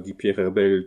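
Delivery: plain reading voice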